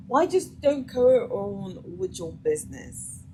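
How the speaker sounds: ordinary talk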